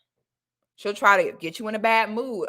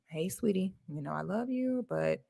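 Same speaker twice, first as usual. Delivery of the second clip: soft, gentle hush; a hint of a mother being sweet in private; loving and private; 9 dB quieter than usual. Mother taking her daughter aside, soft and loving. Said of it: "in a loving and private way".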